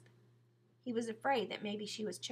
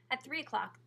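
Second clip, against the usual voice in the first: plain reading voice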